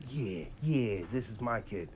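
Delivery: low, soft proud murmur; softly retold fatherly pride; quiet pride. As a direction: murmur it like a smug new dad. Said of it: "so fucking happy and proud".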